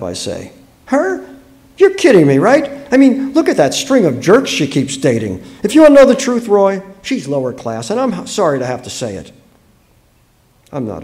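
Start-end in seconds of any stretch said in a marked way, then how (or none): 0.9-9.3 loud, high-pitched scoffing; scornful disbelief. Acted. Wife dismissing the neighbour to her husband, scornful. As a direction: run her down, emphatic and incredulous.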